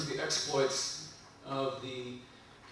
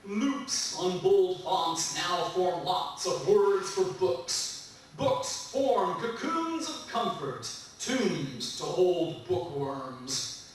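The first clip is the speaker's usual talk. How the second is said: rhythmic, lively recital with bursts of higher pitch; poetry reading; 5 semitones higher, 4 dB louder, slower than usual. Acted. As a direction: perform the poem with relish. Reads poem to audience.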